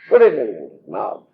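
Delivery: normal talking voice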